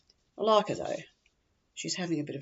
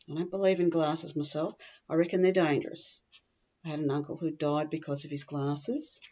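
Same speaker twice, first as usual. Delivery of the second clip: ordinary talk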